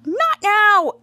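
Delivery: loud, high brush-off; snapping shout. Retold or imitated. Teacher brushing off Jasper, loud high snap. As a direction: bark it at the kid with the flag. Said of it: "Misshapen's like".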